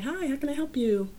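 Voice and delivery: high, bright-pitched polite greeting; chirpy shop-counter voice; customer service voice. Retold or imitated. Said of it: "my customer service voice".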